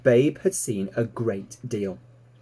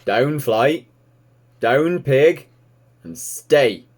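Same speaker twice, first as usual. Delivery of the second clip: louder, terse orders to the dogs; firm farmer command; 4 semitones higher, 7 dB louder, much slower than usual. Acted. Farmer commands his animals.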